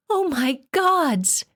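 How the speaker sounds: 高くゆっくり叫ぶ; 絶望の悲鳴のように; scream. A narrator's reading. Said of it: "Therese screamed".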